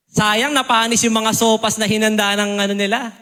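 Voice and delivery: ordinary talk, nothing marked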